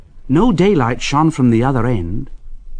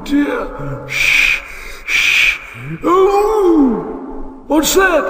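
high, wavering oh dear repeated; fretful engine; much slower than usual. A narrator's reading. Henry alone in the tunnel, high and fretful. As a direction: worry aloud, then startle.

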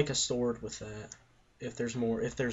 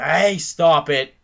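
shout; 5 semitones higher, 14 dB louder, much slower than usual. Real, not acted. Telling friend to stop.

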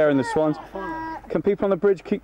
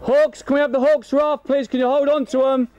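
calling out; 8 semitones higher than usual. Real, not acted. Calling out to Hawks team.

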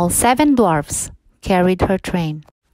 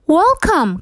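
higher, bright little dwarf voice; cheery dwarf welcome; 9 semitones higher, 3 dB louder than usual. A narrator's reading. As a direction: welcome her in like a jolly dwarf.

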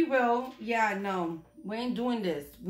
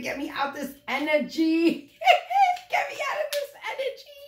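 high squealing laugh through the words; giggling high and fast; laughing plea; 13 semitones higher than usual. Real, not acted. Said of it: "I'm getting mad".

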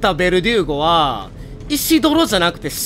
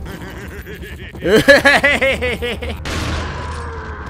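long real laugh; 3 semitones higher than usual. Real, not acted. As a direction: let out a hearty laugh. Laughing at the game.

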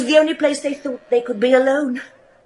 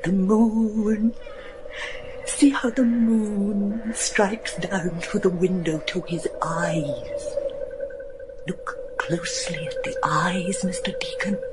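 eerie whisper; 6 dB quieter than usual. Acted. Sybil in moonlight at portrait.

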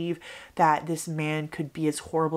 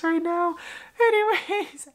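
much higher, silly squeaky anyways; loopy squeak; 12 semitones higher than usual. Real, not acted. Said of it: "I'm getting a little loopy".

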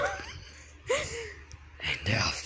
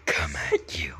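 creepy whisper; 6 semitones lower than usual. Acted. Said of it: "Doing that voice".

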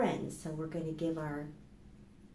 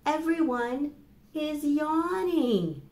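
lively title read; 8 semitones higher, 6 dB louder, slower than usual. Acted. Librarian announces the book title to kids, higher and bright.

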